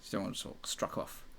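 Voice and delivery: mocking whispered aside; whispering. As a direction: hiss it under your breath.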